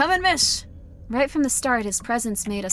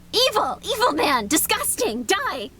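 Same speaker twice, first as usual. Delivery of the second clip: worked-up outburst; 8 semitones higher, slower than usual. Real, not acted. Streamer yelling at the creepy homeowner, worked up. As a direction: rage at the man in a higher voice. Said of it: "I got a little bit too worked up".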